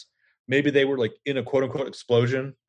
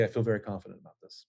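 mock confidence; 3 semitones lower, 8 dB quieter, faster than usual. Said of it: "you say".

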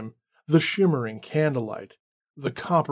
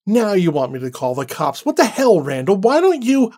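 much higher and louder on "What the hell" and "Why don't you"; angry outburst; 6 semitones higher, 6 dB louder than usual. Acted. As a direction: snap back at him, furious and shrill. Annie rounds angrily on Randall.

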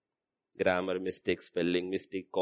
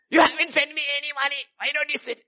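put-on complaining child; 12 semitones higher, faster than usual. Acted phone quarrel over money.

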